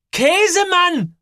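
very high, stretched cry; high shout of disbelief; loud exclamation. A narrator's reading. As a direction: call the name out, drawn out. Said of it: "ruft sie".

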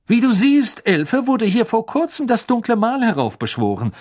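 hohe, laute Beamtenstimme; strenger Beamter. A narrator's reading. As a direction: streng und von oben herab. Mr Diggory speaks. (strenger Ministeriumsbeamter)

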